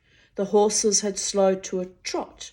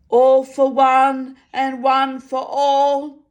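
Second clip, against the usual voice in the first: high, slow, ringing sentry voice; obliging sentry; 7 semitones higher, 9 dB louder, slower than usual. A narrator's reading. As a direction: prompt him helpfully from the dark. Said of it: "the voice said obligingly".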